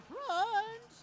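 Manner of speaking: rising to a loud excited call; excited commentary. Real, not acted. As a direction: call the knockout loud and high. Robot pushed off the edge.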